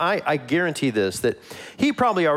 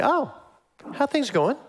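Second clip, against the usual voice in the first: casual question; 10 semitones higher than usual. Retold or imitated.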